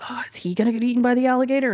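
quick anxious whisper; nervous whisper. Retold or imitated. Viewer watching the swimmer, whispering fast in worry. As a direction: breathe it fast, worried for him. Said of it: "And you're like".

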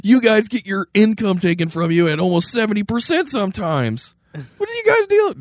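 put-on high squeaky old-timey colonial voice; founding father. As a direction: ham up an outraged founding father. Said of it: "they would have been like".

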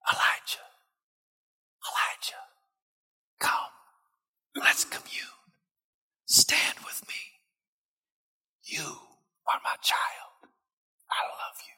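God's voice as a soft unvoiced whisper, slow at the end. Preacher whispering God's call to Elijah, soft and tender. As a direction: breathe the words, tender and hushed. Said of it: "Sometimes it's just a gentle whisper".

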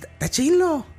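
mock Sinaloa accent, high and stretched. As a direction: imitate a Sinaloa narco saying "Está chilo". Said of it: "En Sinaloa hablan como".

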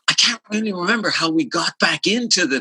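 a hint of a puzzled Mike; imitating Mike. Bandmate forgetting the part in rehearsal: lightly voiced puzzled quote. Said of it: "Mike was like".

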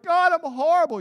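high, loud hammed-up moan; a hammed-up penitent crying out; mock despairing prayer. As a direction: overplay a wretched sinner wailing at God. Mocking melodramatic self-pity prayer.

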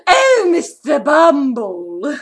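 put-on slow, drawn-out British old lady; old lady British accent. Shown as a demonstration. Showing off a stage role, slow British old lady. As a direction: draw it out like an old British dame. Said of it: "mostly play very old ladies".

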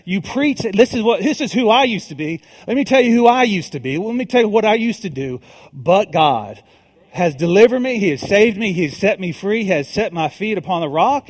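full-force preaching. Acted. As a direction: preach it, lift the hall with each line.

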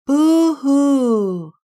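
high loud wailing boo-hoo; crying boo-hoo. A narrator's reading. Lonely beaver wailing across the pond. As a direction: wail it like a sad little beaver.

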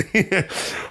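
short unvoiced laugh mid-sentence; airy chuckle breaking in; breathy laugh. Real, not acted. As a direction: laugh through the line.

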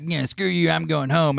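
a hint of a whiny Cartman kid; mild bratty kid voice saying he's going home. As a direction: lightly sneer it like a bratty kid. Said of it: "that's pretty much the voice that we give him".

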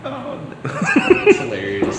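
slow and breathy, laughing through the words. Real, not acted. Friend's karaoke Uber story.

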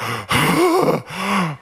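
loud winded gasps; gasping for breath. Acted. Preacher acting out his sprint in sand, loud gasping. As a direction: heave big exaggerated runner's breaths. Imitates panting while running in sand.

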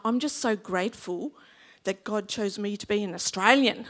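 ordinary talk

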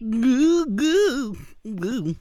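high moaning ghost goo; ghost impression. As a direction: moan it like a spooky ghost. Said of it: "That's my ghost impression".

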